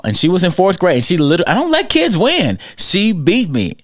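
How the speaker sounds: mock-indignant, laughing at himself; excited disbelief. Real, not acted. Bragging story, losing at tetherball.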